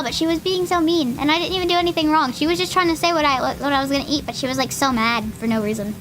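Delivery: normal talking voice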